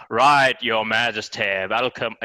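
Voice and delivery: slow, open-mouthed British accent like Churchill; Churchill-style British accent. Shown as a demonstration. Host imitates Churchill's open British accent, slow. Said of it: "Winston Churchill style, which is more open".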